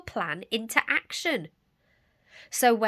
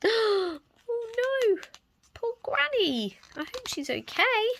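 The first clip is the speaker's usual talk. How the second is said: worried. Acted. Narrator worried aside, high voice.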